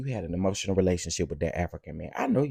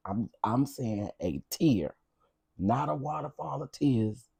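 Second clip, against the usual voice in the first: ordinary talk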